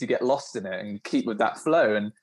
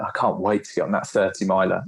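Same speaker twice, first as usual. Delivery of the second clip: normal talking voice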